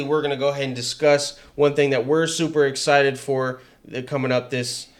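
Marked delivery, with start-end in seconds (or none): none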